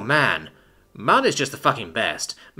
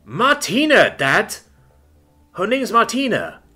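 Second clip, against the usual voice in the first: much higher and louder, insistent exasperation; exasperated correction; 10 semitones higher, 5 dB louder, slower than usual. Acted. Son exasperatedly correcting his dad about the girl's name, loud and high. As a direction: correct him loudly, high-pitched and fed up.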